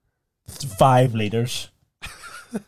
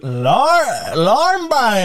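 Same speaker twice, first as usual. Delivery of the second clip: high-pitched, drawn-out mimicry; mock calling out; 9 semitones higher, 3 dB louder, much slower than usual. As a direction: stretch it out high, playfully imitating. Mimics the film line, pointing.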